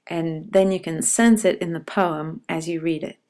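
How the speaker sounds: usual speaking voice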